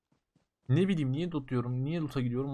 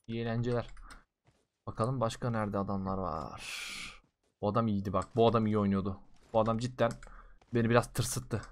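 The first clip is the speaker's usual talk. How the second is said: normal talking voice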